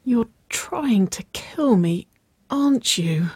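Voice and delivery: slow, breathy, half-whispered accusation; strained mutter. A narrator's reading. Nicole to the teasing girlfriend, mouth dry, strained mutter. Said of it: "she muttered, her voice strained".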